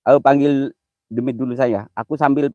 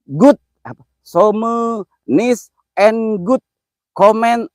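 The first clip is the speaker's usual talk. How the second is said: slow, higher, word-by-word English; struggling through English on stream; halting English reading; 7 semitones higher, 5 dB louder, much slower than usual. Shown as a demonstration. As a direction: sound out the English comment slowly. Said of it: "Gue bingung ngomongnya kalau bahasa Inggris".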